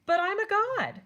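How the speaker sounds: put-on high, loud, proud kid voice; child god brushing off a warning; boastful young god. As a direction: brag like an invincible little god. Ganesha boasts to Mouse.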